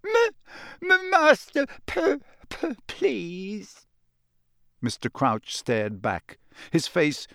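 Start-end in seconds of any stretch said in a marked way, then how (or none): 0.1-3.9 high, tearful elf voice, stumbling over every word; a terrified house-elf stammering through tears. A narrator's reading. House-elf stammering tearfully up at her master. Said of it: "Winky stammered".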